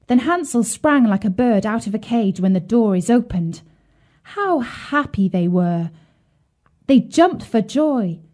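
plain reading voice

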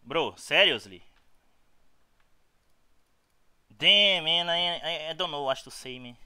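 loud, disbelieving complaint; real gamer frustration; exasperated rage. Real, not acted. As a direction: vent at the screen, fed up. Raging at the opponent's play.

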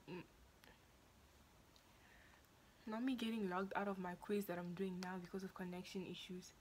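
quiet hushed talk. Real, not acted.

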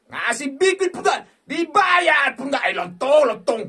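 high, loud yelling, slowed and stretched; furious shout. Real, not acted. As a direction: rage at the stingy game. Angry at slot not paying. (teriakan marah)

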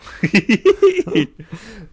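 high wheezy cartoon-dog laugh, real and loud; Scooby-Doo laugh. Real, not acted. Showing his friend his new Scooby-Doo laugh. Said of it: "I've been doing a Scooby-Doo laugh lately".

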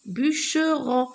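"bûcheron" in three distinct beats; syllable by syllable. Shown as a demonstration. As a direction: say "bûcheron" as bû-che-ron, evenly spaced. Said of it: "On a trois syllabes".

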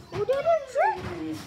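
high excited pet talk. Real, not acted. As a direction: coo praise at the dog. Praising dog.

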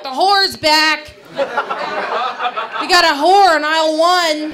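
loud, slow jeering call across the store; mock announcement to the whole pharmacy; mocking pharmacist shout. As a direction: shout it out like a gleeful clerk. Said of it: "the pharmacist would be like".

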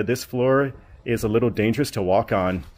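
normal talking voice

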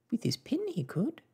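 put-on high young daughter's voice; hopeful daughter. Daughter defends Papa's climbing, high and eager. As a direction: chip in brightly, pitched well up.

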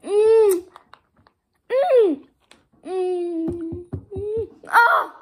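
high savoring hums; eating sour candy. Real, not acted. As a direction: hum through the sour taste.

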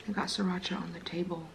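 hushed whisper to self; breathy aside, barely voiced. Real, not acted. Mess while eating.

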